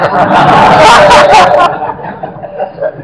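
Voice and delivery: a burst of breathy laughter; laughing. Real, not acted. Preacher laughing at his phone-light joke, breathy laugh.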